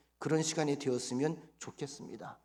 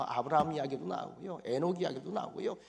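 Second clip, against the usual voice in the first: ordinary delivery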